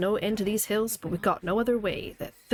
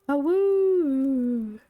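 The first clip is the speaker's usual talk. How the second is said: wolf-like howl; 6 semitones higher, 5 dB louder than usual. Pretend. Howls as daylight comes in game.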